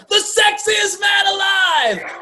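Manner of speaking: high, slow hammed-up announcer; mock announcer. Announcer voice in recording.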